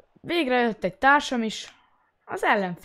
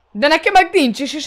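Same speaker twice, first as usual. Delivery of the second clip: excited; 8 semitones higher, 13 dB louder than usual. Real, not acted. Excited outburst.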